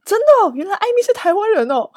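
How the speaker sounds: imagined comment finding she's Taiwanese; mock commenter. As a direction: play a listener finally convinced. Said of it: "有人留言说".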